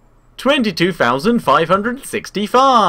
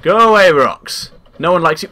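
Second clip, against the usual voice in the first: ordinary talk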